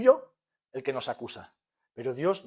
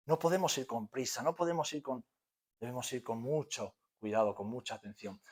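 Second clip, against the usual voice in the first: quiet solemn; 2 semitones lower, 7 dB quieter than usual. Acted. Quiet after climax.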